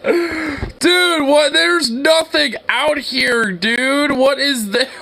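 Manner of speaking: high, cracked-up voice; laughing disbelief. Real, not acted. Incredulous while cracking up at town.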